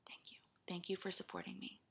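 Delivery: soft unvoiced whisper; whispered thanks. Real, not acted. Vlogger thanking her supporters in a whisper. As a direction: breathe the thanks to the viewers.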